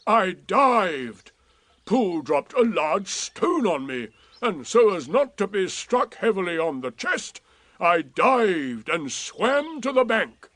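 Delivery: lightly voiced gloomy donkey; slow, dry and put upon; Eeyore. A narrator's reading. Eeyore correcting his friends, lightly voiced and dry.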